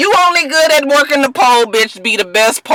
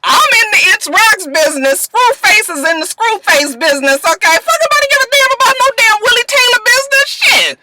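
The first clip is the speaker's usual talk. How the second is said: mock rant; 9 semitones higher than usual. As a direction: rant him down with scorn. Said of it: "I was just like".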